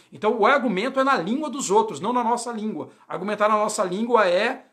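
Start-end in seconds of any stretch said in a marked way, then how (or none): none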